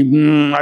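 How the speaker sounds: a short bee-like hum on m. Shown as a demonstration. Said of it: "ऐसे भंवरा ज-, मधुमक्खी वाला". (भंवरे जैसी हल्की गुनगुनाहट)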